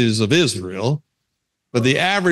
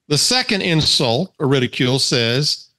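ordinary delivery

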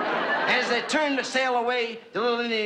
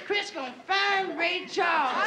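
tipsy Isabel; 9 semitones higher, slower than usual. Acted.

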